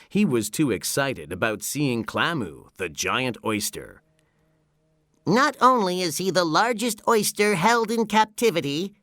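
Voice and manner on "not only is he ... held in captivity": high-pitched, eager cartoon voice; high character voice, showing off a favorite attraction; SpongeBob. A narrator's reading. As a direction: explain it in a high, excited cartoon voice. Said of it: "he explained to Patrick".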